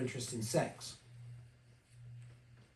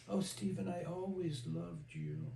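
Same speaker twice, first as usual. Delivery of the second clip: cloying sister voice; 7 dB quieter than usual. Retold or imitated. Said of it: "Don't talk to me in that voice".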